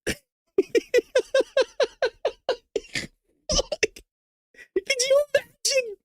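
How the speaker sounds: high, squealing laugh through the words; laughing hard. Real, not acted. Cracking up at his own Trump joke, real high laughter. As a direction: let the laugh take over the line. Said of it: "I was acting like this in the kitchen".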